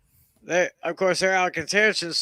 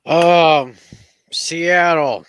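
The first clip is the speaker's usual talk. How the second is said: loud announcement; 10 dB louder, much slower than usual. Acted.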